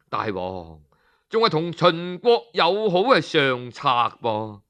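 usual speaking voice

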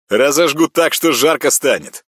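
loud, high confident brag; cocky boast. Acted. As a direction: brag with a grin. Ряба keeps boasting. (самоуверенно)

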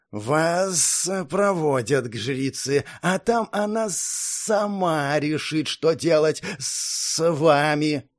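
slow, cold inhuman guard; measured, alien warrior tone; snake guard voice. Acted. Guard voice again.

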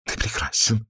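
soft, high, gasping adoration; panting, amorous mutter; breathless murmur. A narrator's reading. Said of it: "задыхаясь, бормотала она".

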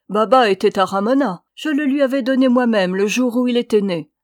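loud, indignant protest; crying out. A narrator's reading. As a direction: burst out in defence, voice rising. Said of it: "s'écria Felipe en bondissant".

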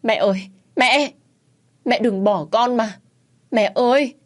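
high, grief-stricken cry; pleading wail of grief; anguished wail. A narrator's reading. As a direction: cry out for a lost mother. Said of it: "Tôi gào lên thảm thiết".

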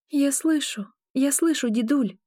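high-pitched, lightly mumbled reassurance; understated thick-tongued reply; mumbling. A narrator's reading. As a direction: answer as if barely awake. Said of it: "промямлила я распухшим языком".

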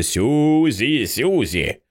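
a narrator's contained version of a mother's yell; wailing call. A narrator's reading. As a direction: call out in a stretched, piercing mock wail. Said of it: "заволала, немов бритвою прорізаючи".

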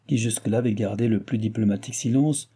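ordinary delivery, nothing marked

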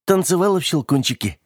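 put-on high-pitched, lightly triumphant girl; solemn pride. A narrator's reading. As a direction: announce it with mild ceremony. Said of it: "торжественно проговорила она".

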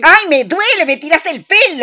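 high, loud yelp of pain, the second line rushed; pained outcry. Acted. Woman whose hair is pulled yelps at her friend in pain. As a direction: cry out as your hair gets yanked.

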